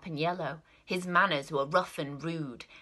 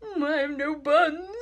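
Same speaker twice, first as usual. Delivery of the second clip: slow, higher, near-crying squirrel; frightened squirrel; 9 semitones higher, 4 dB louder than usual. Acted. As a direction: plead like a scared little squirrel. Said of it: "the squirrel replied".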